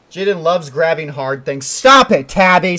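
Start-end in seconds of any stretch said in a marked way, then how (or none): 1.7-2.8 sharp yell at a viewer; yelling at chat. Real, not acted. Streamer yelling at a chat troll to stop. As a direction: cut him off with a loud yell.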